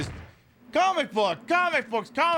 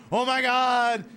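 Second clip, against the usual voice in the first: mock alarm; 7 semitones higher, 4 dB louder than usual. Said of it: "and it's like".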